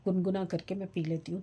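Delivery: ordinary talk, nothing marked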